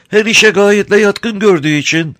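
high, sweet-talking crafty smuggler; sly, sugary old rogue; honeyed voice. A narrator's reading. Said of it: "tatlı konuşuyor".

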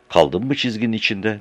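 ordinary delivery

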